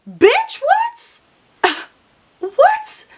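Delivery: very high, squeaked incredulous questions; outraged disbelief. Retold or imitated. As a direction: shoot way up in pitch, appalled. Retold outrage at slammed mirror.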